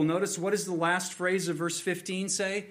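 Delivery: usual speaking voice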